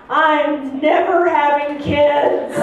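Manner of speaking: deadpan vow. Retold or imitated. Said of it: "I tell her".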